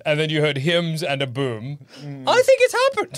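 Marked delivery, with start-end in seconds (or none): 2.3-3.2 very high, squeaky realization; hammed-up prayer-answered amazement; mock astonishment. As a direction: shoot right up high in giddy shock. Acts out prayer seemingly answered.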